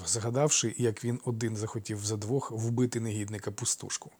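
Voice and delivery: usual speaking voice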